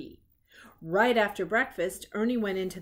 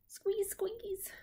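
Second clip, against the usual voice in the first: high squeaky toy noise; soft high squeak of a squeezed toy; squeaky squeeze; 11 semitones higher, 9 dB quieter than usual. Acted. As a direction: squeak it out like a rubber duck toy.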